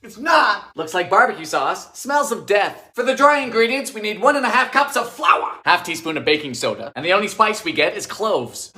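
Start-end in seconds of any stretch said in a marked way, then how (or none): none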